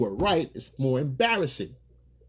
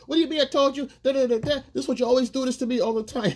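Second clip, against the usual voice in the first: higher, quick, indignant complaint; mock arguing girlfriend; 11 semitones higher, faster than usual. Girlfriend firing back at boyfriend in a fight, quick indignant whine. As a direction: whine it fast like she's fed up.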